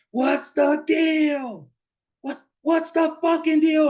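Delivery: very high, loud, drawn-out holler; long stretched mock scream; imitated screaming. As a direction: shriek it like a brother out of his head. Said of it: "didn't know why he's screaming".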